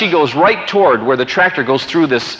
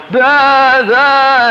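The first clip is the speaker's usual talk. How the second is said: high, loud, drawn-out bleat; imitated sheep bleating; 11 semitones higher, 6 dB louder than usual. Mother ewes at the ditch of lambs, loud mock bleating. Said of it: "the mothers are going".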